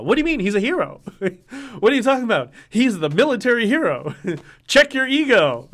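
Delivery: high, loud mocking voice with laughs; mock the fans who call him a hero; sarcastic mockery. Mocking people who praise Tom Marazzo, in a high, laughing sarcastic voice.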